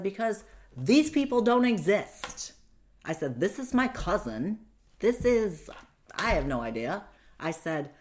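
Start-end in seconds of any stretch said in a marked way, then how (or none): 0.0-2.5 high, loud outraged protest; indignant retold yell. Retold argument with her attacker. 3.4-7.0 high, loud outraged protest; indignant retold yell. Retold argument with her attacker.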